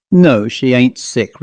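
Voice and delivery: ordinary delivery, nothing marked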